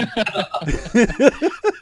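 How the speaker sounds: high loud laugh. Real, not acted. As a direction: burst out laughing. Laughing at joke.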